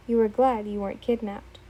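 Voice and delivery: plain reading voice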